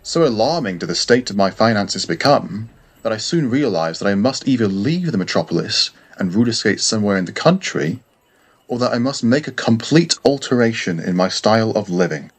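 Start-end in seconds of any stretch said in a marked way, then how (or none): none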